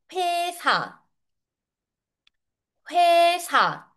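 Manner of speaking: 회사 with a long, high first syllable; model pronunciation. Shown as a demonstration. Korean teacher models a workplace word for learners, model pronunciation. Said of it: "sinasabi natin".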